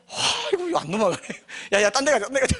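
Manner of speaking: high, comic devil giving up; thwarted devil. Acted.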